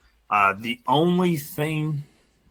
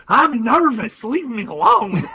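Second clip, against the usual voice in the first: high-pitched mock yell; retold nervous yell; 10 semitones higher, 4 dB louder than usual. Storyteller imitating nervous Bryce snapping back at him, high mock yell. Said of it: "He yells back at me".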